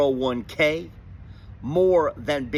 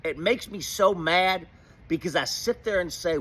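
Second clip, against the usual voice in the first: ordinary talk, nothing marked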